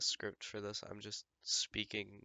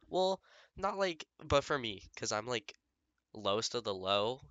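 emphatic; 3 semitones higher, 7 dB louder than usual. Real, not acted. Self-deprecating outburst.

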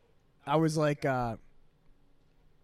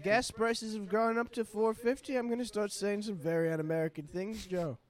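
high put-on presidential voice; Donald Trump; 6 semitones higher than usual. President scolding his rival over gas prices, impersonated. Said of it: "President Donald Trump".

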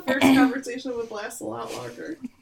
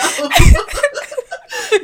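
loud high burst of laughter; 15 dB louder than usual. Real, not acted. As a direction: crack up loudly. Big laugh after the quote.

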